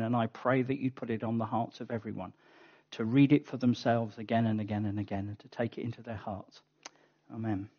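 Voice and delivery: soft, hushed prayer fading away. Acted. Pastor praying over the congregation, hushed and fading. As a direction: pray quietly over the word. Prays before the sermon.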